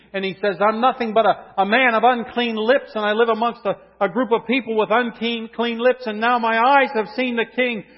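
loud, ringing pulpit voice; a loud, lifted preacher's cry; full-force preaching. Acted. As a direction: proclaim it to the whole room. Preaching Isaiah's confession.

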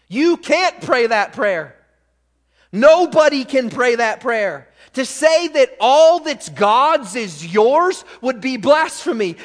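high, loud, forceful pulpit declaration; full-force preaching. Real, not acted. Preacher warns nobody but Jesus can pray that, full-force preaching. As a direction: drive the warning home to the congregation.

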